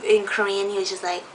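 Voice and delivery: ordinary talk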